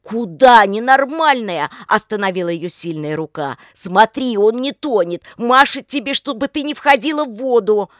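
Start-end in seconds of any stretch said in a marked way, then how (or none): 0.0-1.7 loud, higher, drawn-out scolding; sharp rebuke. A narrator's reading. Oleg halting Lika at the water, loud rebuke. 3.7-7.9 loud, raised, emphatic voice; urgent insisting. A narrator's reading. Oleg keeping Lika out of the water, loud urgent insistence.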